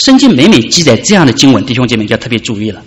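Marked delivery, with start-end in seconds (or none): none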